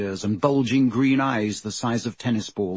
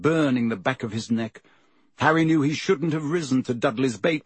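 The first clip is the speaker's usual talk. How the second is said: ordinary delivery, nothing marked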